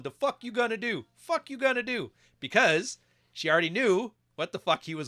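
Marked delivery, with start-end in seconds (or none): 0.0-2.1 high mocking taunt; mild mock taunting girl voice; taunting bar girl. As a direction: goad him like a bar girl spoiling for a fight.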